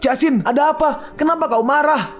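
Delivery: high, confused pleading question; bewildered. Acted. Bewildered husband asks why.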